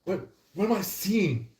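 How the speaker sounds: half-hearted mock bewilderment; mock dazed shock. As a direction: play the blinded crowd, stunned. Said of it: "Everybody is like".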